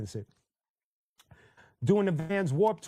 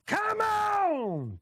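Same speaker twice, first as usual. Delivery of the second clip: much higher, yelled hype call; sample shout. Retold or imitated. Host imitates the [name] track intro for viewers, hyped yell. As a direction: mimic the shout that opens the track. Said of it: "It starts off with".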